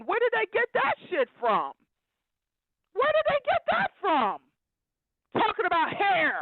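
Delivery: high-pitched indignant demand; exasperated outcry. Real, not acted. Ranting about grown men discussing hair, high exasperated outcry. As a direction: pitch it way up in disbelief.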